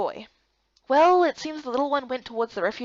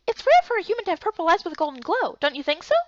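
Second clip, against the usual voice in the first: high, quick chirpy voice; bubbly little companion; a bright little helper, higher and faster; perky girl character; 7 semitones higher than usual. Acted.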